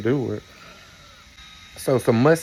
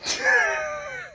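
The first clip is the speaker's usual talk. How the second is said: shrieking laugh. Real, not acted. Laughing at husband.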